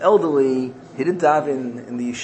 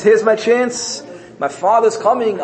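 raised, lightly eager voice; hopeful realization; 7 semitones higher than usual. Acted. Exiled son spots his chance to return, raised hopeful voice. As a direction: act out dawning hope, pitched up. Son's hopeful thought in story.

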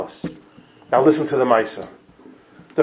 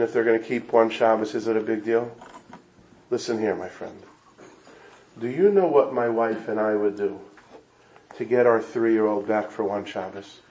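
hushed grief; 4 semitones lower, 8 dB quieter than usual. Acted. Grieving father answers a guest.

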